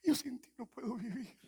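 high, loud hammed-up lover's plea; melodramatic lover. Husband begging his wife not to leave, high and hammed-up. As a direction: overact the heartbroken husband.

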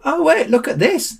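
high-pitched excited discovery; exclaiming. A narrator's reading. Sam spots something and interrupts Ollie, excited exclamation. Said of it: "exclaimed Sam".

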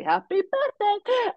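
high, slow, sing-song happy birthday; sarcastic birthday wish. Retold or imitated. Guest mocking her grumpy birthday greeting, sing-song. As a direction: drag it out with mock cheer.

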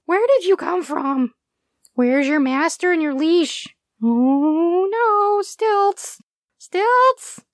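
high, rising panicked little dog cries; a scared small dog crying for its owner; whimpering. A narrator's reading. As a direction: whimper and call for help. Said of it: "Stretch whimpered".

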